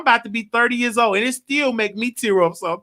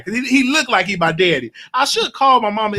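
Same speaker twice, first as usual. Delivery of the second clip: ordinary talk, nothing marked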